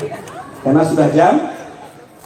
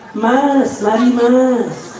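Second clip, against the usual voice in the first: luring call; 4 semitones higher, much slower than usual. Acted. Punning acted call to a man.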